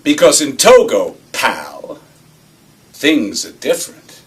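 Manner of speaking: loud, folksy put-down with punched words; gentleman farmer. Acted. Boss schooling the boy on business in Togo, gentleman farmer. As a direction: lecture him like a gentleman farmer. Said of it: "I'm doing my gentleman farmer number".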